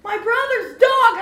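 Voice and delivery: high, very loud shout as the dog's owner; upset yelling. Retold or imitated. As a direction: yell it in outrage, imitating him. Acts out the other man yelling.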